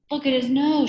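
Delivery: high, loud pupil's shout. A narrator's reading. Eric shouts about the teacher's nose, high loud shout. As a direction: shout it out, pitched right up. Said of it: "shouted Eric Bacon".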